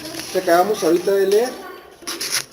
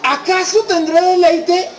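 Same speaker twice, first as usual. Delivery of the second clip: high, slow, drawn-out doubting question; amused disbelief; 7 semitones higher, slower than usual. Retold or imitated. Preacher quotes Sara hearing she will bear a child, amused disbelief. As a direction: lightly act out a scoffing Sara. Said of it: "Sara se rió y dijo".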